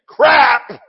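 loud, high mock-daring exclamation; cheeky shouted swear; blurted out. Real, not acted. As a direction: punch it out like a forbidden word. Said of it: "I'm gonna say it".

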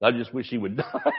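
laughing through the line. Retold or imitated. Friend retelling a road joke, laughing. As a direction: giggle through the punchline.

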